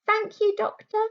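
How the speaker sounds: put-on a small boy thanking the doctor politely; little boy voice.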